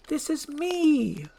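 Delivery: high, slow, drawn-out plea; stretched, high-pitched insistence; pleading insistence. Acted. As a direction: beg to be recognised. Princess pleads to be recognised.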